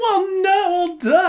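high, loud woman impression; mock woman voice. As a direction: play up a woman's voice. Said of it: "I can't do woman".

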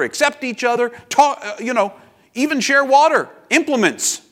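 acted agitation, loud and high; indignant objection. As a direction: build into a high, loud, incredulous protest. Acted indignation of Samaritan woman.

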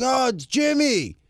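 high, put-on flirty caller voice; prank caller Jimmy. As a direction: ham up a fake bar buddy.

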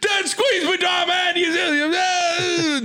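very high shouted squeaky toy rant. Retold or imitated. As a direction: shriek it like a squeezed rubber toy. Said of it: "just a, a rant, an English rant".

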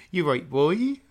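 exaggerated Norfolk accent demo. Shown as a demonstration. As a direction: perform a broad Norfolk greeting. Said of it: "okay, go again".

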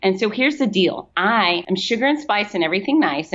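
ordinary delivery

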